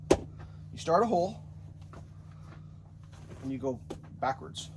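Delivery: usual speaking voice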